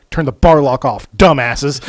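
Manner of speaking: loud, gruff scold. Retold or imitated. Joey scolding the guys who can't start the chainsaws, loud. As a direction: bark it at the clueless guys.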